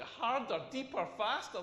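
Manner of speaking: mock-offended repeat with questioning rise; indignant, questioning imitation; incredulous echo. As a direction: throw the words back in comic disbelief. Throws her words back in disbelief.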